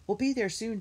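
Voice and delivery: high, mild storybook shout; shouted back. A narrator's reading. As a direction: call back over the wind, high. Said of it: "Jessie shouted back".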